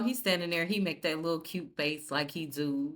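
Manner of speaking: ordinary talk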